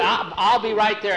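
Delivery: talking loudly through a mouthful; mouth full. Acted. Jackie promising Tom he'll be right there, mouth full. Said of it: "he had a mouthful yet".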